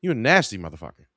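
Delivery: fast, emphatic repeat. Real, not acted. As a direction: rattle off "You a nasty motherfucker" quickly for emphasis. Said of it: "repeated line".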